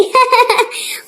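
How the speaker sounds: high laughing burst; amused cackle. Acted. Laughing at the lisping bride.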